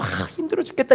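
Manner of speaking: softened mock moan; acted weary groan of someone ill; sick complaint. Preacher imagines himself sick with flu, soft weary complaint. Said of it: "몸살 감기 걸려가지고".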